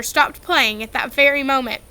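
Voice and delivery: ordinary delivery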